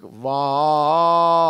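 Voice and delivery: Var with the A held long and loud; stretched vowel demo. Shown as a demonstration. Teacher shows a student the held vowel in Var. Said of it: "şeklinde A'yı uzattık".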